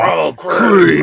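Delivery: loud, raspy hotline creep; creepy caller voice. Retold or imitated. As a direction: growl it like the phone creep.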